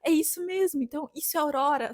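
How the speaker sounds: reliving the moment of seeing green; thrilled exclaiming. Retold or imitated. Under the aurora, telling her group it's real, excited and high. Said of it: "mas eu ficava tipo".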